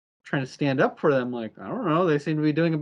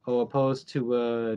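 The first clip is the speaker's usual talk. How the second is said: plain reading voice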